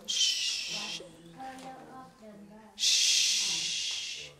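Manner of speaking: a soft teacherly hush for quiet; hushing shh. Real, not acted. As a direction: shush the front row to silence them.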